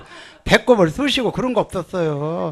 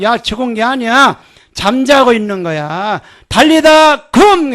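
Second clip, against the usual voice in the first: loud, high command ending slow; Jesus speaking over the dead girl; 4 semitones higher, 6 dB louder than usual. Acted. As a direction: raise the girl with authority. Preacher voices Jesus raising girl.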